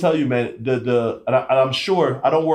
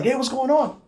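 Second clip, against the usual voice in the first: much higher, upbeat hey; chipper greeting; 10 semitones higher than usual. Retold or imitated. Imitating the chipper officer greeting a sick friend, upbeat. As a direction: greet him cheerfully, full of pep. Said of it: "chipper like he always is".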